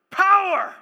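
loud, high shout of the word; full-force pulpit shout; preached cry. Real, not acted. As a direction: hit it like a thunderclap. Preacher's climactic one-word cry.

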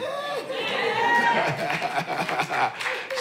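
long, very high held laughter. Real, not acted. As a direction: laugh with pure joy, high up. Preacher laughs ecstatically.

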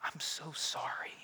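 soft whispered sorry; whispered apology. Acted. Little girl apologizes to her father, whispered. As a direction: breathe it out, ashamed. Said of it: "Finally, she whispered".

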